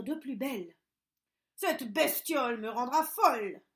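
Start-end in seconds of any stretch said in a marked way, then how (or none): 1.5-3.6 put-on loud, exasperated old witch's curse; cursing crossly. A narrator's reading. Witch cursing loudly at the screaming baby. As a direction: spit it out, at the end of her rope. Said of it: "pesta la vieille".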